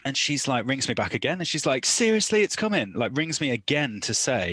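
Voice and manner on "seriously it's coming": high, louder insistence; retold urgency. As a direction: imitate an urgent phone warning. Labouring partner's urgent second call.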